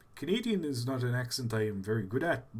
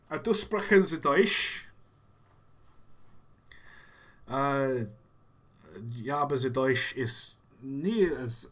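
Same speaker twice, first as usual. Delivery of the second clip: clumsy mock German accent; German accent mixed with bits of German; bad German accent. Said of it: "one of the worst German accents".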